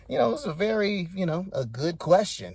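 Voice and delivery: usual speaking voice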